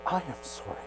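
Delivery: soft whispered "I am sorry"; whispered apology. Retold or imitated. Husband whispering an apology to his wife in church, hushed. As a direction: breathe it into her ear. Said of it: "and I whispered in her ear".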